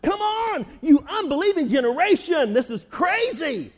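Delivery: frustrated Jesus. Retold or imitated. Jesus frustrated by unbelief, exasperated. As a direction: throw up your hands at them. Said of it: "What frustrated him was".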